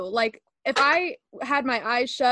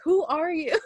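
higher-pitched, laughing disbelief; mock bafflement; 6 semitones higher, 3 dB louder than usual. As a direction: act baffled by a partner's sudden change. Mock bafflement at boyfriend's accent switch.

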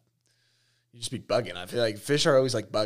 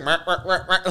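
mock bleating fish noises; 6 semitones higher, 5 dB louder than usual. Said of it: "Just like".

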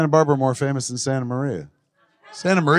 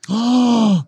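surprised gasp; 10 semitones higher, 4 dB louder than usual. Real, not acted. Reacting to teacher news.

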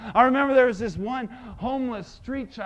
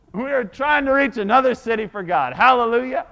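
laughs through the words, louder; laughing; 2 semitones higher, 9 dB louder than usual. Real, not acted. Preacher laughing about the older team, laughing. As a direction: laugh it out joyfully.

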